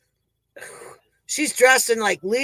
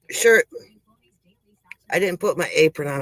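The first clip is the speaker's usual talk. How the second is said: usual speaking voice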